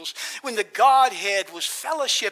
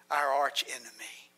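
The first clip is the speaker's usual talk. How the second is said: whispered; 9 semitones lower, 7 dB quieter than usual. Real, not acted. Preacher drops to whisper on enemy.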